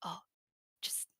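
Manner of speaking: hushed whisper. Retold or imitated. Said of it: "and I'd be like".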